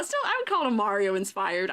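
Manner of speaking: ordinary talk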